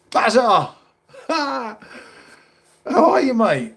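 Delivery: high, loud, laughing shout hello; excited greeting. Real, not acted. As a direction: call out to a mate with delighted laughter. Calls out greeting to arriving friend.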